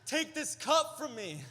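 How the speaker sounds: high, loud, over-the-top despair; a comic cry of anguish with a laugh; mock-dramatic plea. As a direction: ham up a biblical plea to God. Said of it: "I'm like".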